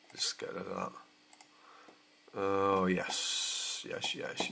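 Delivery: ordinary talk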